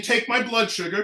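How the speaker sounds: normal talking voice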